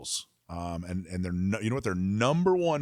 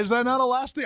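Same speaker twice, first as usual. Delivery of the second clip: high, fast, mock-baffled; incredulous; 14 semitones higher, 5 dB louder, faster than usual. Real, not acted. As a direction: pitch way up in disbelief. Repeats headline, incredulous.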